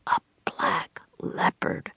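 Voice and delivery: slow, hushed awestruck whisper; breathed whisper. Acted. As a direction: breathe it out, scared to be heard. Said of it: "breathed Jack".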